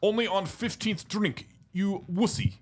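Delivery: put-on Russian accent. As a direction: invite him out for vodka, heavily accented. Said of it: "Russian's pretty bad".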